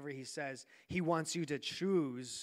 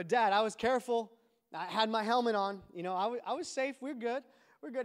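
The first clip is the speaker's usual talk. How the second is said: higher-pitched, hurried self-defence; pleading excuse; 5 semitones higher than usual. Retold or imitated. Boy defending himself to his dad, pleading. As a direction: imitate a kid talking his way out of trouble.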